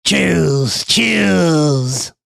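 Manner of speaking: low, slow booming god voice; deep Gozer voice. Retold or imitated. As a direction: intone it like an ancient deity. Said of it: "Gozer shows up".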